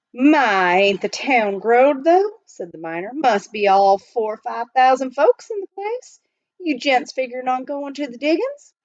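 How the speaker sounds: put-on old miner voice. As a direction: play the chatty gold miner. Miner chatting.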